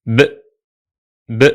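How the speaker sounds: a lone voiced "b"; isolated b sound. Shown as a demonstration. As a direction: say "b" alone, twice. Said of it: "The letters sound as".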